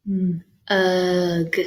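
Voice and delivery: Mm held alone, then a stretched ugh; said slow. Shown as a demonstration. Teacher helping find the middle sound, said slow. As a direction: stretch out Mm, ugh in two parts. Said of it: "say it slow".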